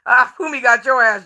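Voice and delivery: ordinary talk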